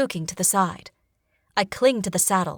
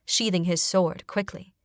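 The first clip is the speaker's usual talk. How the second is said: ordinary delivery, nothing marked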